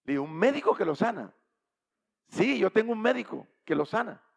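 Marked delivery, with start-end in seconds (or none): 0.2-1.3 louder, amused disbelief; incredulous scoff. Retold or imitated. Wife questioning the stranger's doctor, incredulous. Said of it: "ella se rió". 2.3-4.2 loud, higher, firm affirmation; emphatic assurance. Retold or imitated. Stranger answering the doubting wife, emphatic assurance.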